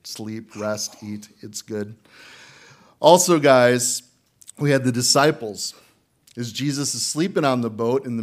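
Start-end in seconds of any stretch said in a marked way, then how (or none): none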